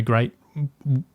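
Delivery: ordinary talk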